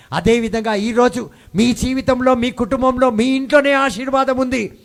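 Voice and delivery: loud preacher climbing to a high pitch; full-force preaching. Real, not acted. Preacher promising blessing in the family's house, full-force preaching. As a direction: build to a ringing proclamation.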